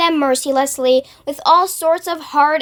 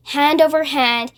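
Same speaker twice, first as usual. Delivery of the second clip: ordinary delivery, nothing marked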